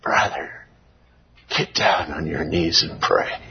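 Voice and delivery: mild breathy imitation of a man between gasps; imitating gasping. Preacher quotes asthmatic brother between gasps, mildly breathless. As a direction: lightly voice a gasping sick man, low. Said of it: "And between gasps, he says".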